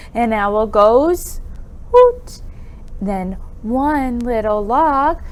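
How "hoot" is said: high, hooting owl call. Pretend. Teacher hooting like the owl in the rhyme. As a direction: hoot like a little owl.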